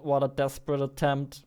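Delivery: ordinary talk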